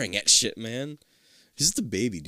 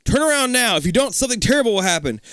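urgent warning; 9 semitones higher, 4 dB louder than usual. A narrator's reading. Narrated warning line.

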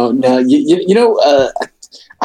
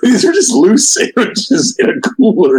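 laughing; 9 semitones higher than usual. Real, not acted. Absurd loose sandwiches found.

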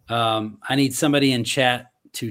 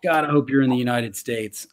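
normal talking voice

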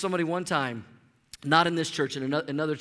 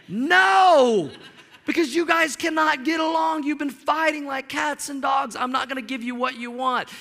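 mock stern refusal; 6 semitones higher, 3 dB louder than usual. Father refusing McDonald's to squabbling kids, acted scolding. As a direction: play an exasperated dad laying down the law.

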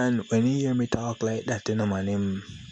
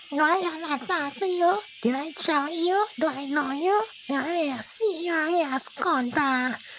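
very high mock female coworker in a quarrel; mock lady arguing back. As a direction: play the lady snapping back. The lady answering in the argument.

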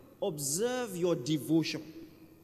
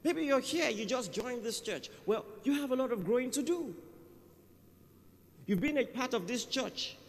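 preaching at full force; 6 semitones higher than usual. Real, not acted. Preacher exhorting congregation.

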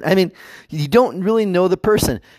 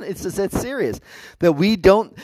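ordinary delivery

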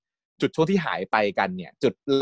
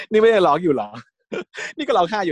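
higher, louder teasing through laughter; fond teasing; 6 semitones higher, 3 dB louder than usual. Retold or imitated. Tin teasing crying Kan, laughing fondly. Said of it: "เขาก็เอ็นดูอะเนาะ".

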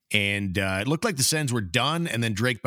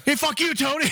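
high-pitched mock holler; comic far-off shout; distant dog yelling insult. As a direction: hurl it across the neighborhood. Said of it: "the dog in the distance goes".

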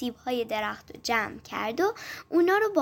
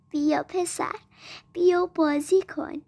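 ordinary delivery, nothing marked

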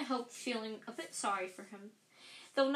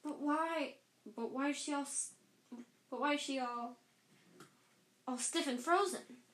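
higher, halting, near tears; choked and distraught; 4 semitones higher than usual. Acted. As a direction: Filch stammering in disbelief over his cat. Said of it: "choked Filch".

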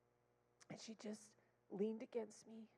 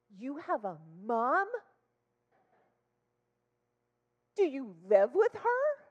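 put-on loud, incredulous little-girl questions; imitated astonished child; 4 semitones higher, 14 dB louder, slower than usual. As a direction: blurt it like an amazed kid. Child astonished therapist has mom.

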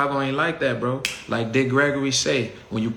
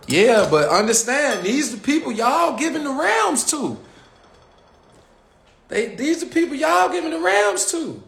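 high-pitched rant; 13 semitones higher than usual. Real, not acted. Ranting to Chicago fans about who they back, high-pitched and exasperated. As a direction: voice jumps way up, ranting.

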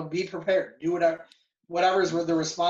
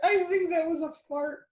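laughing; 11 semitones higher than usual. Real, not acted. Cracking up at a friend.

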